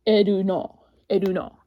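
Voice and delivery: "Edu no" said katakana-style, syllables even; "Edu no" with Japanese vowels; Japanese-style name. Shown as a demonstration. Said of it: "In Japanese, it's like".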